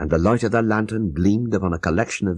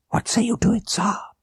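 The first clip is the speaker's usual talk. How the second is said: whispered, pitched up; hushed question; 14 semitones higher than usual. A narrator's reading. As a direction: secret whispered ask. Abdullah asks in hush.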